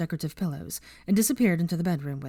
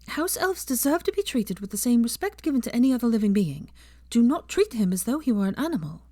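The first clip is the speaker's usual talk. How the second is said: higher, earnest lecturing voice; Hermione, lecturing; 6 semitones higher than usual. A narrator's reading. Hermione lecturing Pansy about elf rights, earnest. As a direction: stand on principle, high and earnest.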